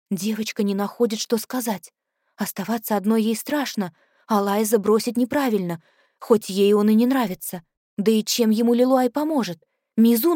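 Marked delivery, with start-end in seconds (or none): none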